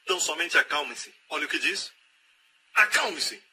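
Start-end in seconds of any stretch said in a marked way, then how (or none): 2.7-3.4 preacher orders the anxious believer, firmly; firm command. Acted. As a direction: command the worrier to settle down. Preacher tells worrier to be still.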